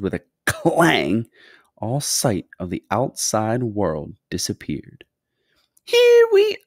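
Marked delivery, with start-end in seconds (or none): 5.8-6.6 very high, loud, drawn-out announcement; gleeful high-pitched shout; triumphant cry. A narrator's reading. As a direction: sing it out slowly in a fluty voice. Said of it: "cried Mister Wonka".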